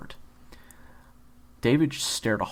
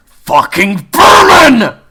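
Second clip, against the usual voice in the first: slow, very loud, high furious scream; rage scream; 13 semitones higher, 22 dB louder, much slower than usual. Acted. Kyler screaming at David in rage, slow furious scream. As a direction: let pure rage tear out. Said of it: "he screamed".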